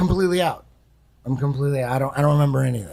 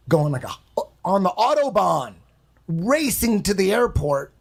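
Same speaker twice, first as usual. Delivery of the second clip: normal talking voice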